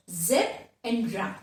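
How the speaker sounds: "zeb" and "ra" set apart; syllable by syllable. Shown as a demonstration. Teacher shows how zebra divides, syllable by syllable. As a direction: sound out "zeb and ra" as two beats. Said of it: "So we pronounce it as".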